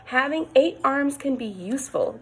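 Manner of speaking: usual speaking voice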